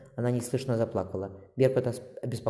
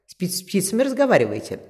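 higher, louder playful question; amused; 8 semitones higher, 6 dB louder than usual. A narrator's reading. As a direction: tease with light amusement. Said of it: "раздался смешливый голос". (смешливо, с лёгкой поддёвкой)

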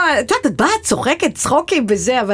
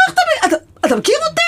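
much higher, fast disbelief; astonished; much faster than usual. Retold or imitated. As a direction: retell your shock at being recognized. Retells police chief knowing her. (הפתעה גבוהה ומהירה)

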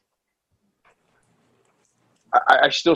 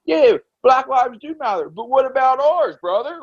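higher-pitched mocking imitation of a protesting guy; imitated man's objection; 8 semitones higher than usual. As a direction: mimic a man pleading his side. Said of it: "and the guy's like".

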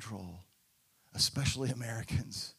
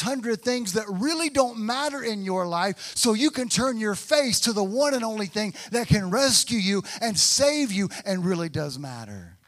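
louder, higher, driving preacher's build; preaching at full force; 6 semitones higher, 7 dB louder than usual. Acted. Pastor urging the congregation to turn their backs on distractions, preaching at full force. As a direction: preach it up to a climax.

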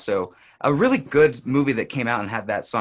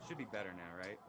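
far away; 6 semitones lower, 6 dB quieter than usual. Real, not acted. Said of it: "You still kinda sound far away".

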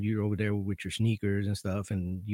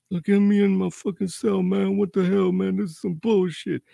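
higher, louder furious griping; pissed-off inmate; 10 semitones higher, 7 dB louder than usual. Retold or imitated. Inmate complaining to the officer, furious griping. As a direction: vent like a locked-in inmate. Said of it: "He was pissed".

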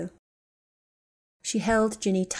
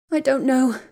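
higher, louder words pushed out while crying; tearful, strained reply; forced through tears; 6 semitones higher, 7 dB louder than usual. Acted. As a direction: squeeze it out between sobs. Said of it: "Ginny forced the words out and started crying harder".

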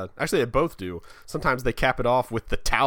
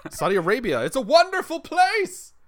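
mock propaganda; 5 dB louder than usual. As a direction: gush like a state ad. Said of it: "propaganda got me again".

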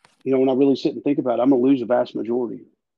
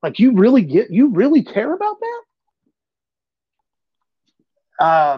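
retold rant; 7 semitones higher than usual. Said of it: "I just went off on 'em".

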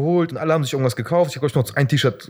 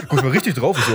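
ordinary talk, nothing marked

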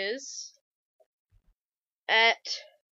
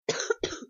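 coughing fit; 4 dB quieter than usual. Real, not acted. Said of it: "I'm sorry I keep coughing".

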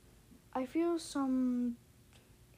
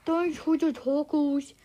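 slow, louder, garbled words round food; mouth full; 3 semitones higher, 5 dB louder than usual. A narrator's reading. Kid thanks mom while eating, slow mouth-full garble. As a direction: mumble it thickly as if chewing tacos. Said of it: "I say with my mouth full".

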